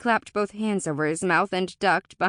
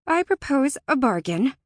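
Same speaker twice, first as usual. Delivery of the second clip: higher, louder, resolute announcement; bold proposal; 6 semitones higher, 5 dB louder than usual. A narrator's reading. Alora grips her sword and proposes terms, resolute. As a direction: declare it with squared shoulders.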